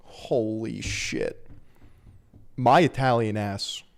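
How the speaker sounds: ordinary talk, nothing marked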